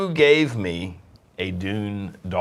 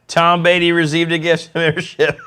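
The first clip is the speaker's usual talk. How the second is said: loud, high excited chatter; both thrilled by the gift; 9 semitones higher, 7 dB louder than usual. Real, not acted. Excited reaction to gift memberships.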